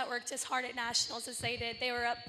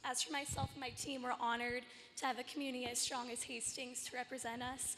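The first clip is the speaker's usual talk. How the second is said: normal talking voice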